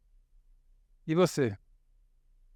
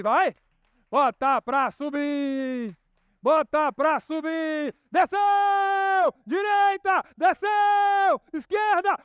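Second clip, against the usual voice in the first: building from chant to high shouts; roller coaster ride; 8 semitones higher, 3 dB louder, much slower than usual. Pretend. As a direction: ride it all the way to the loop. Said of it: "Vamos na montanha-russa".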